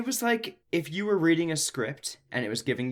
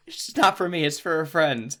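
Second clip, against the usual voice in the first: higher mock teen excuse; sulky teen; 5 semitones higher, 5 dB louder than usual. Teen tells grandma the beer isn't hers, higher mock sulk. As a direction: play the sulky teenager. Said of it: "she's like".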